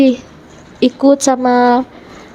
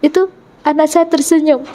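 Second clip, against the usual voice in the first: ordinary talk, nothing marked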